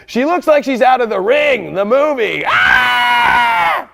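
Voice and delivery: high, loud mock scream of fright; hammed-up horror shriek. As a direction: shriek like you just saw the ghost girl. Horror movie ghost card.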